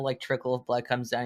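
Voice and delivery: usual speaking voice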